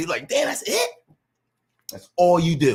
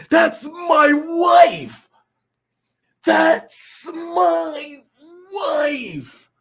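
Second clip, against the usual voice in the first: hammed-up crying shout, much higher; mock tearful cry; 9 semitones higher, 4 dB louder, much slower than usual. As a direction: cry it out like a wounded husband. Acting out crying Will Smith.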